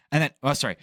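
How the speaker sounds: normal talking voice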